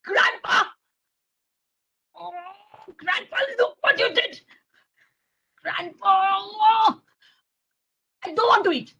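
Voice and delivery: high, whining tantrum voice; acted childish outrage; angry child. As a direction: wail in protest like an upset little boy. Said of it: "I'm getting so angry".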